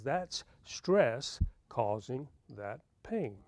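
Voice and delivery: slow, soft, deliberate doctor's verdict; quiet calm diagnosis. Retold or imitated. Dentist friend repeats quietly that the pain is stress. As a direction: say it slowly and gently, case closed.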